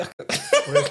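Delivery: squeaky high laughing yes; a yes swallowed by laughter. Real, not acted. Storyteller answers his friend while still laughing.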